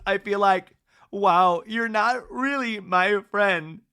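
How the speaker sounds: hammed-up hurt accusation; mock tearful. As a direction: act out tearing up, emphatic and drawn out. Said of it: "she's tearing up in the talking head".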